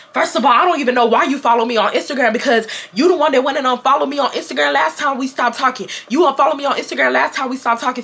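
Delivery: rapid-fire retort in one breath; mimicking a fast, heated comeback; fast, passionate rant. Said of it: "talk that fast and that passionate".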